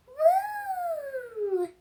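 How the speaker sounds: a thin, very high werewolf howl; little werewolf howls way up high; squeaky high howl. Acted. Werewolf pup howling a squeaky high woo. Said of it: "howled so high".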